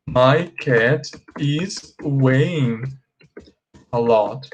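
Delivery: slow, drawn-out incorrect sentence; slow wrong example. Shown as a demonstration. Teacher showing students the incorrect sentence, slow and drawn out. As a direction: stretch out the wrong version so they hear it. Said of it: "A frase incorreta seria".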